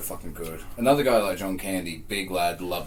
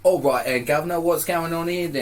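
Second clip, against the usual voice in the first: high, hammed-up English bobby accent; badly faked English accent, pitched way up; English accent attempt; 10 semitones higher, 5 dB louder than usual. Shown as a demonstration. Guest shows co-host his English accent, badly faked. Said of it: "Do you do your English accent now".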